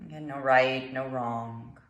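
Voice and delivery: calm meditation guide, long pauses between phrases; guided meditation. Acted. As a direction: guide them gently through the breath, unhurried.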